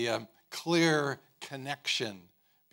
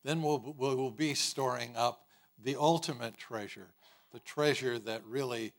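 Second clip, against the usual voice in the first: ordinary talk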